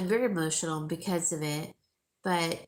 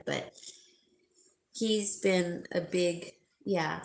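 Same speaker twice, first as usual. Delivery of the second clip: usual speaking voice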